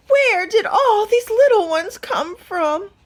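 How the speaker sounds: high, loud clucking hen voice. A narrator's reading. Hen startled by the goslings, high clucking squawk. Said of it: "Henny clucked".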